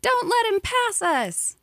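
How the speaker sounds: put-on child's shout. A narrator's reading. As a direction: yell it from the back seat. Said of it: "I shouted".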